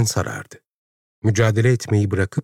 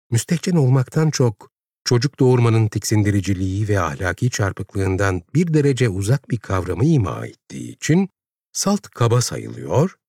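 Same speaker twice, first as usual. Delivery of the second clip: ordinary delivery, nothing marked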